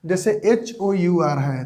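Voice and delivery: ordinary talk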